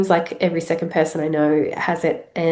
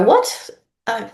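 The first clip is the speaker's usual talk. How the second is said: surprised; 4 semitones higher than usual. Retold or imitated. Imagined listener's confused reaction.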